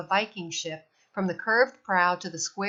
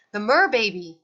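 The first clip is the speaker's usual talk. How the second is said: put-on loud, high call after the baby; sudden startled yell; 3 semitones higher, 6 dB louder than usual. A narrator's reading. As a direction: shout to stop her. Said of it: "he yelled".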